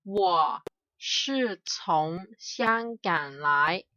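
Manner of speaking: ordinary talk, nothing marked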